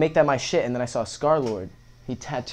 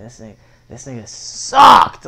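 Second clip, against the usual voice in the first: louder, drawn-out outrage at the tattoo; louder, slow verdict; 2 semitones lower, 10 dB louder, slower than usual. Retold or imitated. Teen repeats his complaint about the tattoo artist, louder and slow. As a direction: hammer the complaint, slowly.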